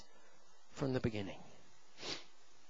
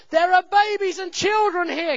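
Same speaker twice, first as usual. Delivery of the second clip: raised, high, urgent call; loud retold warning to the crowd; wife's loud call; 9 semitones higher, 7 dB louder than usual. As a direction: call out over a crowd in alarm. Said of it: "my wife says loudly".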